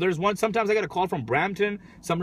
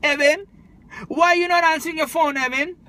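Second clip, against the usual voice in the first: put-on Trini accent; 9 semitones higher than usual. Caller voiced in retold story.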